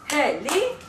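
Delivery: "Heli" in two separate beats, rising at the end; syllable by syllable. Shown as a demonstration. Teacher leads everybody together, syllable by syllable. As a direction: model "Heli" for repeating, syllables apart. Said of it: "everybody, and properly".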